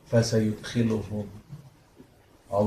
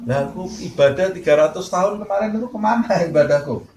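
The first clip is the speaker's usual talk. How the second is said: higher, louder indignant servant's complaint; indignant protest; 7 semitones higher, 4 dB louder than usual. Acted. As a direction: demand back three hundred years of worship. Servant protests his worship.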